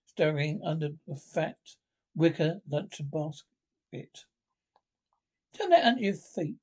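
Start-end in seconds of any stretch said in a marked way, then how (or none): 5.5-6.6 offhand Rat. Acted. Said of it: "he observed to the mole".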